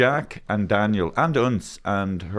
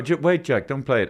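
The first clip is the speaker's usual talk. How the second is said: normal talking voice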